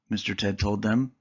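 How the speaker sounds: ordinary delivery, nothing marked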